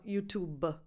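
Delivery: Italian-accented "YouTube" with extra schwa after the B. Shown as a demonstration. Teacher showing the common mistake, Italian-accented "YouTube-uh". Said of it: "Because with an Italian accent".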